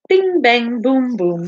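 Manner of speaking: normal talking voice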